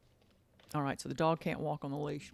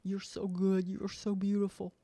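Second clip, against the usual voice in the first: praising the dog; 3 semitones higher than usual. Pretend. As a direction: coo lovingly down at the dog. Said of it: "You might look down".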